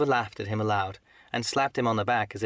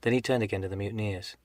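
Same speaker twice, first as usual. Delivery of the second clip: plain reading voice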